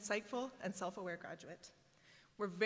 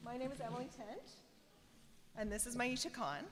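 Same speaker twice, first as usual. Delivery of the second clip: ordinary talk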